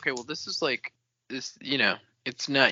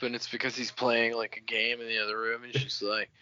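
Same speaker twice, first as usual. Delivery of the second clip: normal talking voice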